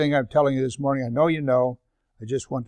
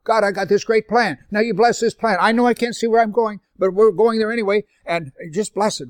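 higher, quicker, insistent praying; pushy mock prayer; 6 semitones higher than usual. Preacher mimics bargaining with God, pushy. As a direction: press God to approve your own plan.

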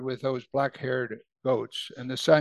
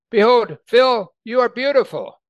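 calling out; 8 semitones higher, 7 dB louder than usual. Acted. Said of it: "He's calling out".

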